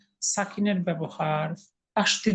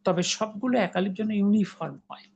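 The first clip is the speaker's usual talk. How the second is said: whispered aside. Real, not acted. Teacher stresses a rule.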